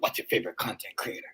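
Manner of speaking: low, hushed horror-film voice; scary movie voice. Pretend. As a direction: creep it out like a horror movie villain. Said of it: "I'm gonna be sounding like a scary movie".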